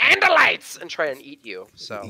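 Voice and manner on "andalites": high, raised cry; mock battle cry. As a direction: call it out like a hammed-up villain. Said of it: "I would definitely go".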